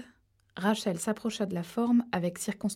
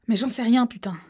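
loud, higher exasperated outburst; 4 semitones higher, 6 dB louder than usual. Acted. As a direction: snap it out, fed up. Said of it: "s'exclama Harold avec exaspération". (Harold exaspéré, dans l'arbre, explose de colère)

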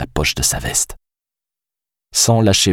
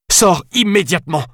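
shouting; 13 semitones higher than usual. A narrator's reading. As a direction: yell it at him, gun raised. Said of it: "répéta-t-elle".

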